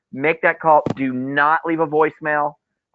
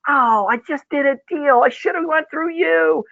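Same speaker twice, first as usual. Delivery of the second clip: pitched-up, sheepish mock confession; guilty client; 11 semitones higher than usual. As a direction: client admitting they used someone else, a bit higher. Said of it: "They go".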